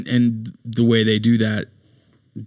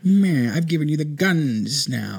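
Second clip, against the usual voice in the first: mustache twirling villain; 4 semitones higher than usual. Retold or imitated. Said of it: "just evil mustache twirling version".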